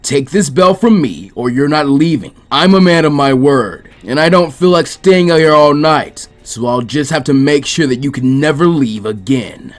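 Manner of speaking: loud, heavy threat; menacing threat. Acted. Jiraiya threatening Naruto, loud and menacing. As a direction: lean on him with killing intent.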